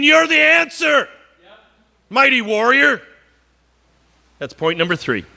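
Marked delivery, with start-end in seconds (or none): 0.0-3.0 higher, ringing call of God. Acted. Preacher voicing God calling Gideon mighty warrior. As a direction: lift it up like God naming him. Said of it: "And God says to Gideon".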